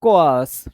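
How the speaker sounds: कोआर्स said in two beats, "ko-aars"; careful pronunciation. Shown as a demonstration. Teacher showing students how to say कोआर्स. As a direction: spell out कोआर्स clearly as "ko-aars". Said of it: "इस तरीके से इसका pronunciation होता है".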